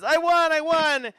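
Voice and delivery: high, loud victory cheer; jubilant. Acted. Girl jumps after winning the wrestle, jubilant. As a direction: crow like an overjoyed kid. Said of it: "She jumps up and down".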